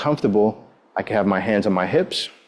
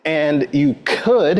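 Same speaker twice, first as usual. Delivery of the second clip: could drawn out slow and high; stress on could; 3 semitones higher, much slower than usual. Shown as a demonstration. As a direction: lean hard on could, slowly. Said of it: "I emphasize that word could".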